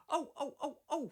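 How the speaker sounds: high, loud startled cries; screaming trucks. A narrator's reading. Silly trucks jolted by Edward's sudden pull, high loud startled squeals. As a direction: squeal in shock as you're yanked. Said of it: "screamed the trucks".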